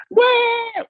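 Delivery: put-on very high baby "Where?" with a falling tune; a dad mimicking his daughter's high falling "Where?"; imitating baby waking. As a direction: squeeze "Where?" up high and let it drop, like a toddler waking from a bad dream. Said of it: "She says".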